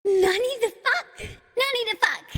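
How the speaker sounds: high, laughing outburst of disbelief; hammed-up anime-style shock; mock shock. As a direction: blurt it high, half laughing.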